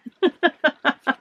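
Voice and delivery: a short high laugh. Real, not acted. Laughing at her puppet thumbnail, a short high laugh. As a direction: giggle at the thumbnail shot.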